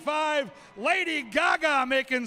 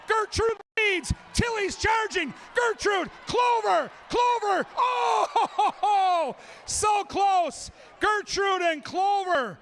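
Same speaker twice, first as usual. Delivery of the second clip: rising thrill of a close race; excited race call; 6 semitones higher than usual. Real, not acted. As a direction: call the finish like it's neck and neck. Live race call, corgis running.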